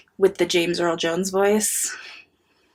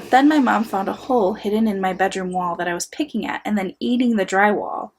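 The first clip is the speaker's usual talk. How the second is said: ordinary talk, nothing marked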